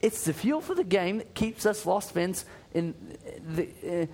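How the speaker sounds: attempted British accent. Reading a UK listener's email to the cohost in a wobbly British accent. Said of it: "I'm Australian. I can't go English".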